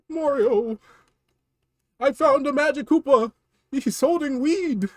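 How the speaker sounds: high, pleading little-brother voice with laughs; whiny Luigi. Acted. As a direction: squeal for Mario's help.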